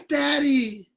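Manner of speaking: high, loud pleading call; a grown son crying out for his father; son calling dad. Retold or imitated. Yosef reaching for his father, high pleading call. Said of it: "Yosef's like".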